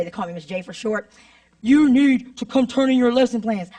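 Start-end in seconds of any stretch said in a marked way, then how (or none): 1.6-3.7 put-on intercom announcement voice; principal on intercom. Principal summons teacher mid-class over the intercom, mock yell. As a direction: mildly imitate a principal calling out over the intercom. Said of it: "my principal yells out over the intercom".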